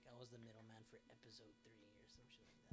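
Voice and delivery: whispered slogan, barely voiced. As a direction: breathe the shirt text out like a secret. Shirt slogan said under breath.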